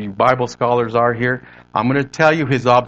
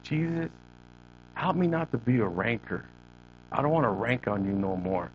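soft, voiced prayer sinking lower; quiet prayer; 3 semitones lower, 11 dB quieter than usual. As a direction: imitate a humble prayer, hushed and unhurried. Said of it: "what's your prayer?".

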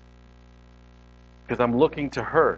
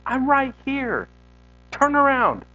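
high, drawn-out preached call; God calling out; 9 semitones higher, slower than usual. Acted.

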